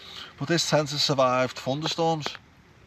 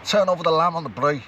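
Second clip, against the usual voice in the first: put-on higher, louder stab at a South African accent; 5 semitones higher, 5 dB louder than usual. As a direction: call out like a South African at the braai, accent hammed up. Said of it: "my attempt at a South African accent".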